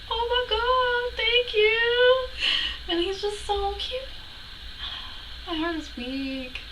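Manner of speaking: very high, drawn-out squeal; tiny high voice, stretched words; overwhelmed swooning. Retold or imitated. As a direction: squeak it like a melting fangirl. Swooning over idol taking her sticker.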